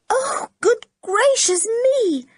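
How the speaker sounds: high, slow, drawn-out exclamation; girl realising her mistake, embarrassed; embarrassed surprise. A narrator's reading. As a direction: gasp it out, flustered. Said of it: "going rather red".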